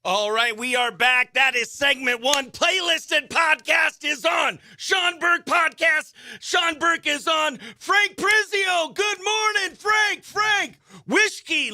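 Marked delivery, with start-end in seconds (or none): 2.5-11.7 much higher, slow hyped call-outs; excited shout-outs. Real, not acted. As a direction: hype up each viewer by name. Calling out viewer names excitedly.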